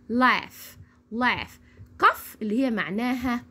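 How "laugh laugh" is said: "laugh" ending in a clear f. Shown as a demonstration. Teacher models the word to repeat, "laugh" ending in f. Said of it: "الجي والاتش عملت معايا صوت ال".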